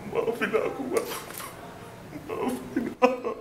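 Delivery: acted sad. Shown as a demonstration. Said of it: "Sekarang acting sedih".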